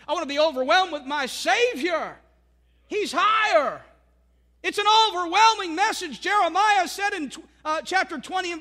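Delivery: rising, loud preacher's cadence, stretching key words; loud, lifted sermon climax; full-force preaching. Acted. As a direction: build the congregation up, declaring it.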